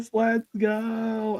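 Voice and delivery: high, slow, drawn-out cheer; hyped mock cheer. Retelling fans cheering her karaoke, hammed-up high drawn-out hype. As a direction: imitate fans cheering at a singer. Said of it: "And they're like".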